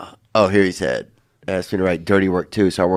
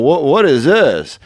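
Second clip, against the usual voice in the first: Norm Macdonald impression; 5 semitones higher, 3 dB louder, slower than usual. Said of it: "one of the ways that Norm makes you laugh".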